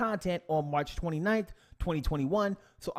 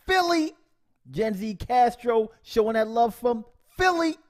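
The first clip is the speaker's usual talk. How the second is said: excited shout-out; 7 semitones higher, 5 dB louder than usual. Real, not acted. Shouting out a viewer's city.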